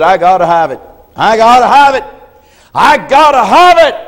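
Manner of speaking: rising desperate plea; desperate pleading. Acted. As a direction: beg for it like your life depends on it. Jacob begging desperately.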